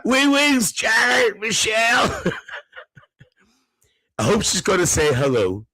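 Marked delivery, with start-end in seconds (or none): 0.0-2.2 high-pitched shout; excited calling out. Retold or imitated. Reads out viewer's excited call.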